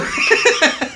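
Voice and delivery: high breathy burst of laughter; giggling at the memory. Real, not acted. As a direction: crack up at your own story. Laughing after retold yell.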